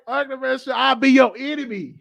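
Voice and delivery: high-pitched comic pleading; mock protest. Offering to be her enemy instead, mock protest. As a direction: push the voice up high, about to laugh. Acted joking protest, breaking into laughter.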